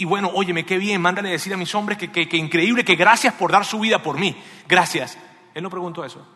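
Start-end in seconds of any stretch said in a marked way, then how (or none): 0.0-5.2 loud, rousing gratitude; effusive thanks. Acted. King thanks his men after victory, loud and effusive. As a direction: pour out thanks at full voice.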